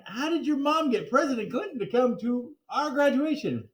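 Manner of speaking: plain reading voice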